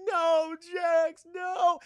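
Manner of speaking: high, loud hammed-up anguished cry; mock crying wail. Sonya crying over maimed Jax, mock anguished wail. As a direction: wail for a fallen friend, overdone. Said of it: "And Sonya's crying".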